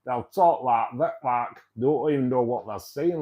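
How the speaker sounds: mock Sheffield local talk; Sheffield accent. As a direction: do an exaggerated Sheffield accent, pushed out loud. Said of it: "that's what they talk like".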